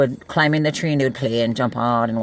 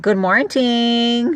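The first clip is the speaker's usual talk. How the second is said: high, very slow drawn-out hello; sing-song greeting; 9 semitones higher, 4 dB louder, much slower than usual. Real, not acted. Auntie greeting her fellow Hawaiian viewers playfully. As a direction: stretch the morning out playfully.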